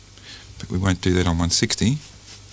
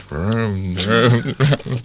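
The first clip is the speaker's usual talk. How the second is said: motorbike vroom; 3 semitones higher, 4 dB louder, much slower than usual. Retold or imitated. Motorbike noise imitation.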